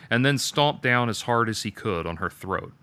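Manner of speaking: usual speaking voice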